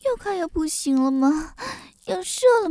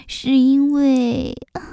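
ordinary talk

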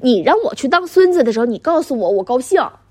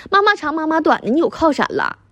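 ordinary talk, nothing marked

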